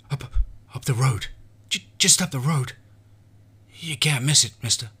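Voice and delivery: high, loud frightened stammer. Acted. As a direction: stammer out directions. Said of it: "the boy stammered".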